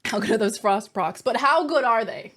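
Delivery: normal talking voice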